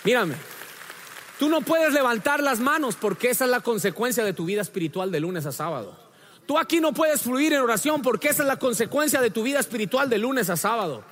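loud, high, driving sermon delivery; fiery preaching. Real, not acted. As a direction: preach it at full force to the congregation. Preaching at full force.

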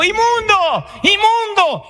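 high-pitched mock cry of alarm; imitated child's shout. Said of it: "y gritó".

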